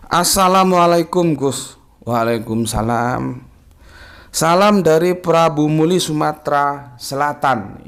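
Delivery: reading a viewer's greeting on air; slow comment reading. Acted. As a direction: read each word of the greeting carefully.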